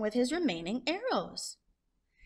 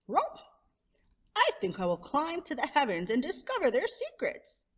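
excited idea; 6 semitones higher than usual. Acted. Coyote voiced announcing his idea.

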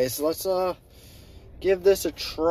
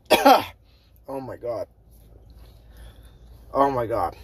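gasping at spicy heat; burning mouth exclamation; mouth burning from spicy food; 6 semitones lower than usual. Real, not acted. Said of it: "my mouth is burning".

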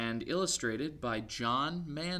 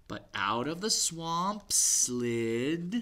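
lively picture-book read-aloud; story reading to children; 6 semitones higher, 10 dB louder than usual. A narrator's reading. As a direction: read it to little ones.

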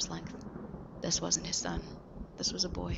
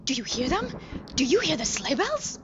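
higher, rising breathless questions; excited wonder; 8 semitones higher, 5 dB louder than usual. Acted. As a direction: marvel at the sound. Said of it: "he exclaimed".

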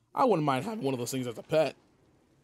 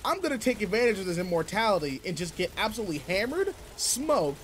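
much higher, rising, talking himself into it; defensive; 6 semitones higher than usual. Acted. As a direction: justify yourself, getting more worked up. Defending his drinking habits.

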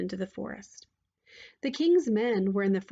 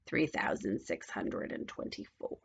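very low, soft witch; witch counting gold; 12 semitones lower, 7 dB quieter than usual. A narrator's reading.

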